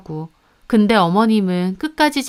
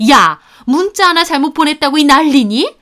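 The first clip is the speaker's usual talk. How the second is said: high, loud indignant yell; 문자 하나 with a shrill rising scold; shouting mother-in-law; 6 semitones higher, 6 dB louder than usual. Acted. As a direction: rise on 문자, outraged at the fuss.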